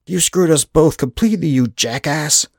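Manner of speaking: loud, high-pitched angry tirade; furious outburst. A narrator's reading. Rudolph blames Anders for ruining them both, furious. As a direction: hurl the insult in outrage.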